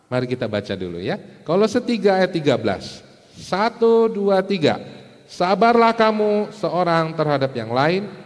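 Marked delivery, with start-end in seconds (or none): 3.3-4.8 loud, high count-in for a group. Real, not acted. Counts congregation in to read together. 5.2-8.1 loud, high, slow scripture reading; measured, raised-voice verse recitation; leading group reading. Real, not acted. Leads congregation reading the verse aloud.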